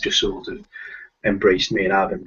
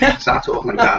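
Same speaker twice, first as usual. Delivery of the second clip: thick Scouse accent, pitched up high; 13 semitones higher than usual. Shown as a demonstration. Showing John his broad Scouse accent, high and thick. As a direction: switch into broad Scouse for the line. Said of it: "when I wanna start talking proper Scouse".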